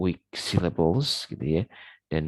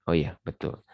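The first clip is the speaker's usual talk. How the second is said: normal talking voice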